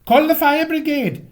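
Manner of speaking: high, loud, panicked shout; a housewife shrieking for the fire brigade; frantic cry. Acted. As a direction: yell for help over the gong. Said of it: "cried Missus Prothero".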